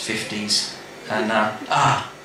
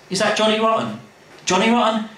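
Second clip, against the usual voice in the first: high, arch questioning; mock-posh question; 9 semitones higher than usual. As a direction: ask it like a scandalised toff. Lee mimics a question.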